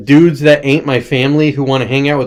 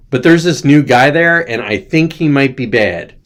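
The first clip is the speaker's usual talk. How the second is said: usual speaking voice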